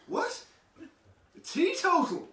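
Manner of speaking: high, slow disbelieving what; mock disbelief on the phone; astonished.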